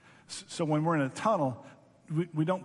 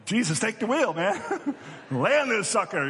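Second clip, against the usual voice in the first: higher and louder, laughing it out; laughing mock plea; 6 semitones higher, 4 dB louder than usual. Pilot joking to Jesus to land the plane, laughing. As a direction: hand over the controls with a laugh.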